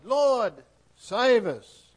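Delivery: loud, high plea for rescue; urgent loud prayer; crying out. Acted. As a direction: cry it out from the storm. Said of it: "they cried".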